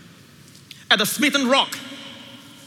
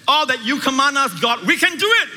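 loud, high, cocky promise; boastful vow; 7 semitones higher, 4 dB louder than usual. Retold or imitated. Israel boasting loudly to God at Sinai. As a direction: shout it like you can't fail. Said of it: "an emphatic statement of self-confidence".

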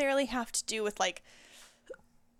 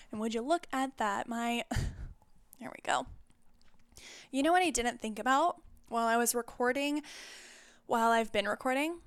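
ordinary talk, nothing marked

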